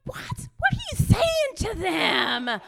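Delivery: a loud, slow, stunned what; shocked. Retold or imitated. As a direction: blurt it in disbelief. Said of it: "I'm just like".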